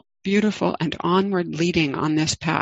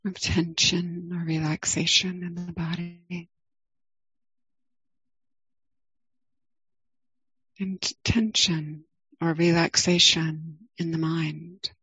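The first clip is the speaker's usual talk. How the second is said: guided meditation. Acted. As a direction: ease listeners into the body, gently.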